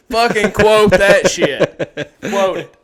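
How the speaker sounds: slow, loud, laughing call; real laughing shout; laughing hype. Real, not acted. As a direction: yell it through laughter. Laughing, hyping the quote.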